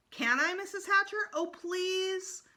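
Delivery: put-on high, pleading little girl; begging. A narrator's reading. Sheila begging the mother to babysit, high and pleading. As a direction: beg eagerly, high. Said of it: "begged some more".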